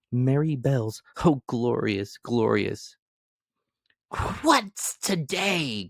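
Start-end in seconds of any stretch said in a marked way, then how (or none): 4.4-5.9 much higher, slow, drawn-out call; calling out of the window. A narrator's reading. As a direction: call down to a boy in the street. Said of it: "cried Scrooge, calling downwards to a boy".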